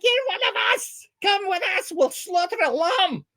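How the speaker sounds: very high mock-Scottish rallying cry; excited fake-Scot holler; Scottish accent. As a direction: whoop it up in a hammed-up Scottish burr. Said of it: "They're like".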